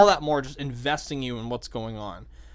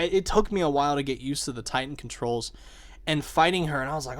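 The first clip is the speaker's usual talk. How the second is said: ordinary delivery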